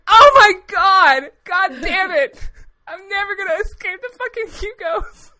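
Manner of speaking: loud, high, laughing outburst of disbelief; exasperated outburst. Retold or imitated. Retelling the audience question to a friend, exasperated shouting. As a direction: throw up your hands and yell it out. Reliving the Hugo question at event.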